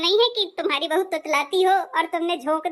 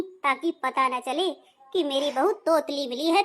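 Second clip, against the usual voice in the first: ordinary talk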